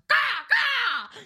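high, loud squawking cry; bird squawk. Pretend. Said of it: "interrupting but with bird sounds".

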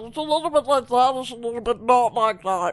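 throat-closed strained put-on voice; ridiculous voice. Host disses cohost in a throat-closed voice. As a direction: keep the throat squeezed and tease. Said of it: "diss you in a fucking ridiculous voice".

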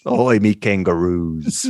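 slow, low, hammed-up Australian accent; Australian accent attempt. Shown as a demonstration. Cohost showing off a bad Australian accent on request, hammy. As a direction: botch an Australian accent, drawing the words out. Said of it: "Can you do an Australian accent".

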